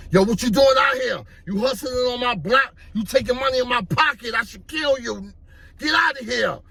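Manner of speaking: high-pitched, fast confrontational demand; acted angry threat. Gunman confronting a young hustler on his block, acted angry threat. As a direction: bark it like an armed block boss.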